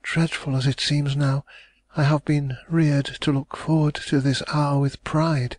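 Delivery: hopeful heiress pressing for news; young lady, anxious. A narrator's reading.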